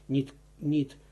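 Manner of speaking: normal talking voice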